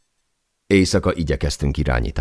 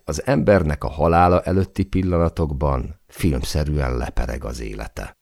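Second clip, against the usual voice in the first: usual speaking voice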